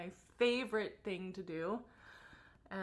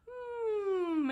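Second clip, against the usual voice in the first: high buzzing hum in the mask; 12 semitones higher, 5 dB louder than usual. Shown as a demonstration. Teacher humming into the mask, high and buzzing. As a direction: buzz the lips on a high hum. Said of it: "we'll just hum and chew".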